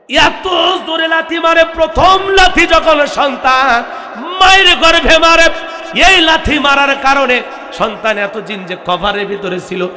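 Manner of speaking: loud, high impassioned preaching; preacher crying out to the congregation about a mother's pain, loud and high; full-force preaching. Real, not acted. Thundering sermon, building in pitch and volume.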